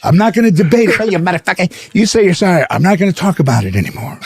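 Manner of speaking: mock heated argument. Pesci arguing in retold fight.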